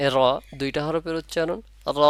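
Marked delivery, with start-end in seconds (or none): 2.0-2.0 র with its vowel held out one beat; one-alif stretch. Shown as a demonstration. Teacher showing both letters of র, lengthened. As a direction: draw র out briefly and evenly. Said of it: "এক আলিফ টানলাম".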